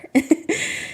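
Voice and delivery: soft airy giggle; a quick unvoiced laugh; breathy laugh. Real, not acted. Laughs about commitment issues.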